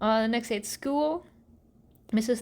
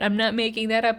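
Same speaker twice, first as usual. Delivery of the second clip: normal talking voice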